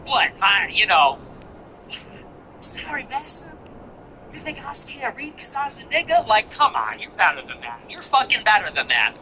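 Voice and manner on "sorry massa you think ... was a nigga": high, softer caricatured servile voice; mock servile. Mocking her race-card plea, high mock-servile voice. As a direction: imitate a grovelling victim, pitched up.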